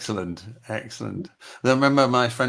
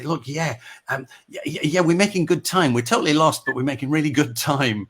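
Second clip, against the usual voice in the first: higher, insistent phone patter; raised-pitch comic reassurance; acted phone excuse; 6 semitones higher, 4 dB louder than usual. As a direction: keep up the optimism though lost. Acting out a phone call.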